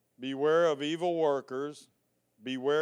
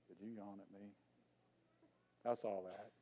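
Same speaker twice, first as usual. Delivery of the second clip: much softer aside to one listener; quiet teasing aside; 7 semitones lower, 19 dB quieter, faster than usual. Real, not acted. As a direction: drop the voice and tease the yawner.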